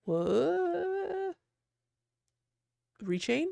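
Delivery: high drawn-out squeal of disbelief; a stunned, high-pitched what; surprised. Real, not acted. Reacting to the wheel landing on a game, stunned.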